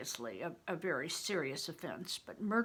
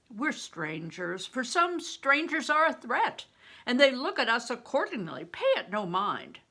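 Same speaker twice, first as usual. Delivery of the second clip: a louder, lightly voiced older companion; Bear, gruff companion; 5 semitones higher, 5 dB louder than usual. Bear warns the boy to hurry, louder and higher. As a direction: speak as a worldly protector, urging haste.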